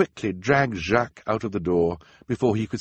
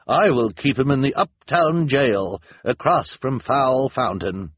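Luciana announces; 6 semitones higher, 6 dB louder than usual. A narrator's reading. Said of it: "Officer Luciana announced".

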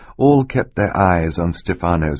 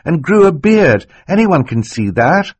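put-on high, louder, urgent child voice; acted high-voiced girl, insistent; urgent girl's accusation; 11 semitones higher, 6 dB louder than usual. A narrator's reading. Violet insists on her accusation.

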